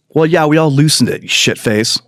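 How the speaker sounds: normal talking voice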